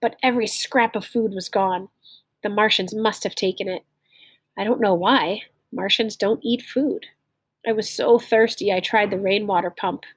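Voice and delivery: clear, lively read-aloud storytelling; story narration. A narrator's reading. Teacher reading a story aloud to learners, lively storytelling. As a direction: read the tale to the class with feeling.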